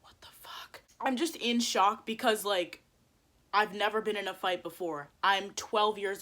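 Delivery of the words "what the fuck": barely audible, voiceless disbelief; retold whispered reaction; stunned whisper. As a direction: whisper it in shock.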